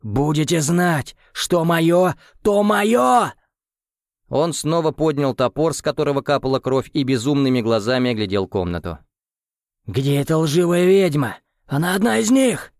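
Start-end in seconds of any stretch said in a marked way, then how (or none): 0.0-3.3 loud high furious roar; angry shout. Acted. Krem gloating after the strike. 9.9-12.7 high, loud furious yell; angry shout. Acted. Krem raging about the witch.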